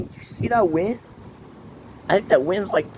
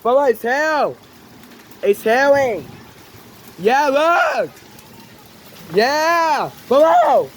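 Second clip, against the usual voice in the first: excited high shouting over hail; in a hailstorm; 11 semitones higher, 6 dB louder than usual. Real, not acted. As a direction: yell out, thrilled and shocked.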